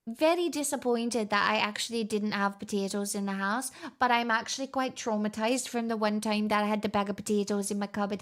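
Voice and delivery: mock Irish storytelling lilt; put-on Irish accent. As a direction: chat in a hammed-up Irish accent. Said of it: "I actually just have a really natural Irish accent".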